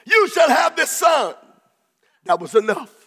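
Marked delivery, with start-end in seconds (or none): 0.0-1.4 shouted high, full force; ringing proclamation. Acted. Preacher shouting the promise to the congregation. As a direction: proclaim it like a prophecy fulfilled.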